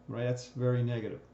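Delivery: ordinary talk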